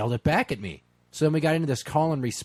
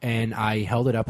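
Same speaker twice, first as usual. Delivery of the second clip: ordinary talk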